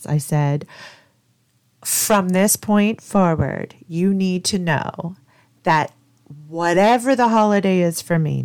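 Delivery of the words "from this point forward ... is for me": firm retold warning, pitched up and deliberate; no uncertain terms. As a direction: lay down the law, word by word. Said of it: "in no uncertain terms".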